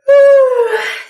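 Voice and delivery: big high sigh; loud tired sigh. Pretend. Trucks tired at night, a big sigh. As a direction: sigh like worn out.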